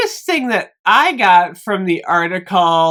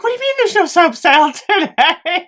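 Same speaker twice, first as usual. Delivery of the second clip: 1 incredulous; 9 semitones higher than usual. Retold or imitated. Disbelief at store, retold.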